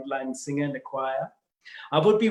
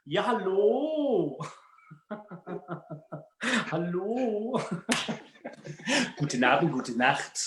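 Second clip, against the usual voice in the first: jokey drawn-out German greeting; slow, hammed-up German speaker; German accent; 3 semitones higher, much slower than usual. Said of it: "in a German accent".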